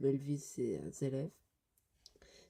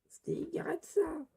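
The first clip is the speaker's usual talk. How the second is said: very high falsetto protest; squeaky pleading; slower than usual. Acted. Lector begging Sting to stop, squeaky pleading. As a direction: beg a friend to stop, very high.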